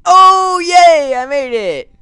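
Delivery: loud, high victory yell; triumphant cheer. Real, not acted. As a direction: shout the win at the top of your voice. Finally clears the level.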